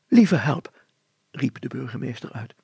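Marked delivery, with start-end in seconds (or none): 0.1-0.8 higher, louder cry of dismay; mild shocked exclamation; startled mayor. Acted. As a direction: throw up your hands, mayor.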